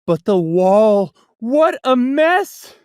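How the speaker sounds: loud slowed dismay; dismayed exclaim. A narrator's reading. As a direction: cry out at the mess. Narrator dismayed by mess.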